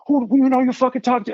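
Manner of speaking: high, fast mock yell; retold scream. Friend jumping between them at Bobby, imitated screaming. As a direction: imitate an outraged challenge without a full shout. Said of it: "like, screaming at Bob".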